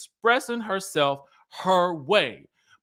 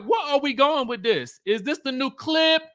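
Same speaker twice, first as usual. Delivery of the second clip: baffled disbelief; 8 semitones higher than usual. Retold or imitated. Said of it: "And I'm like".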